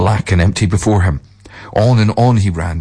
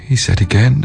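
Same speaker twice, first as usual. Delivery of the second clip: plain reading voice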